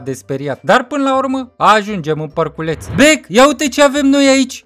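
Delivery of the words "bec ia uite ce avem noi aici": high, loud character voice; taunting friend. Acted. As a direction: crow it at your buddies, pitched up and loud. Mocking friend voiced in story.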